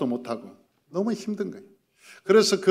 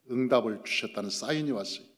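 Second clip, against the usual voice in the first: usual speaking voice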